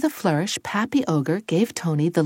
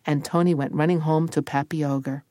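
plain reading voice

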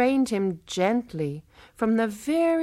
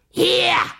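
sharp cry; 10 semitones higher, 9 dB louder than usual. Acted. Marome grabs his wrist.

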